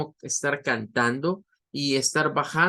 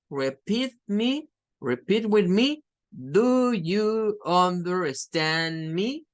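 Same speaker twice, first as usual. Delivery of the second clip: slow, higher teacher voice, every syllable full; mock English teacher; 4 semitones higher, slower than usual. Imitating a typical English teacher drilling the class. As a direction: play the patient classroom teacher. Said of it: "Es lo típico que se suele hacer en clases".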